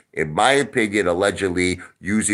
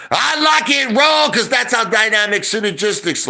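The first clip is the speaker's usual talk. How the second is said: much higher and louder mock yell; taunting shout; 12 semitones higher, 3 dB louder than usual. Streamer taunting a rival to his chat, loud mocking shout. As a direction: belt it out to taunt him.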